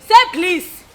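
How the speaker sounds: loud, sharp demand; shouting. Acted. Woman yelling at a rival over the phone, loud. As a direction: bark it at her. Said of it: "Why do you keep ... shouting?".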